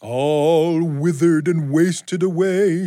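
slow, high, eerie ghost voice; drawn-out spooky moan. Acted. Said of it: "she says in a spooky voice".

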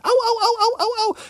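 high, steady wailing oh's of a fleeing granny; long high cries running down the road; hollering granny. Acted. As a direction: holler as if the gourd's beating her. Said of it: "went down the road a hollering".

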